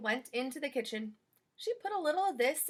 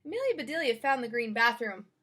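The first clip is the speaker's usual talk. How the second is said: ordinary delivery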